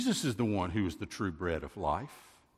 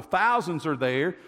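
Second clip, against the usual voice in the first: ordinary delivery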